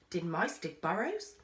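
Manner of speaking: ordinary delivery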